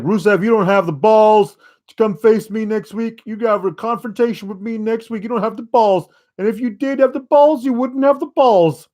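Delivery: jab each balls at him; mock Lashley taunt. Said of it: "Bobby Lashley's like".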